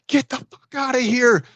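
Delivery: high, strained imitation of an angry outburst; mock crazed yell. Drop-in visitor retells man ordering him out, mock crazed yell. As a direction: act out someone suddenly losing it, pitched high. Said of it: "he starts going crazy".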